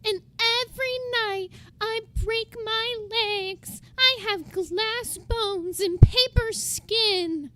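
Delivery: loud, slow mock whine about glass bones; mocking fragile victim. Streamer mocking a crybaby, in a loud slow whine. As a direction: overdo a fragile victim's pity plea. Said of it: "Head ass".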